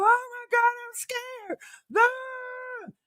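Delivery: high, whimpering mock fear; fake-scared squeal; mock fright. Mocking Gigi afraid of new trends, high whimpering.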